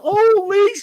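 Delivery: loud, high-pitched cry of amazement; stunned outburst. Retold or imitated. Host retelling his shock at the reveal, amazed yell. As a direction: burst out, re-enacting the shock.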